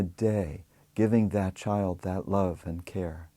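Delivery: unhurried meditation voice with long pauses; guided meditation. Acted. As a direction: guide them gently into relaxation, leaving space after each phrase.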